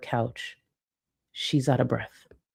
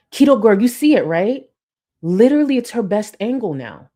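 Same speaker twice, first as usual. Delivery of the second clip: excited; 6 semitones higher, 7 dB louder than usual. Real, not acted. Mocking commentator's exaggerated outburst.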